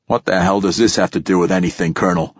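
ordinary delivery, nothing marked

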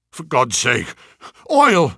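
acted plea of a man in agony; agonised cry. A narrator's reading. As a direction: cry out for relief from pain. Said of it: "he cried".

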